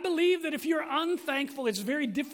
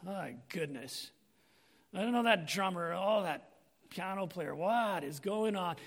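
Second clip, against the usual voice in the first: lower, sour mock complaining; grumbling churchgoer; 8 semitones lower, 5 dB quieter than usual. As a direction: mimic a grumpy worshipper picking faults. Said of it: "you're gonna be like".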